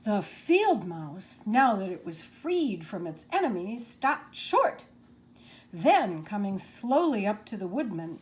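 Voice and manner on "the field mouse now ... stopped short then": usual speaking voice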